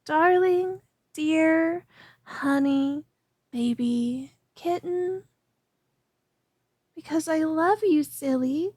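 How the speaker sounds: ordinary talk, nothing marked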